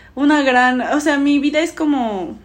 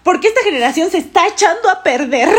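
mock authoritative teacher, higher; bossy teacher; 5 semitones higher than usual. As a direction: lecture the class like you're in charge. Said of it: "Yo mando aquí".

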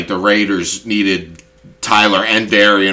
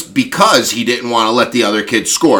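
usual speaking voice

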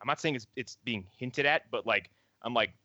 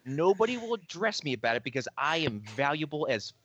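higher, smug imitation of a cocky engineer; smug mock boast; 5 semitones higher than usual. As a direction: brag it like an untouchable employee. Voicing smug Galen.

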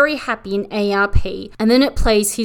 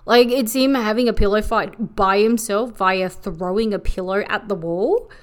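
ordinary talk, nothing marked

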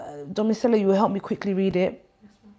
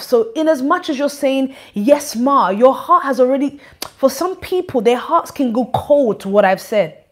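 louder, higher exhortation from the pulpit; preaching; 5 semitones higher, 5 dB louder than usual. Acted. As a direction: press it home to the congregation. Exhortation at raised force.

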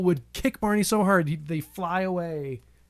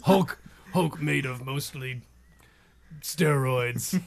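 slow, lower, halting monster voice; Hulk; 5 semitones lower, 3 dB quieter, slower than usual. Acted. Co-host playing the tiny Hulk, in Hulk voice. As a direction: talk like a dim Hulk, low and slow. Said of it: "the Hulk is just really tiny".

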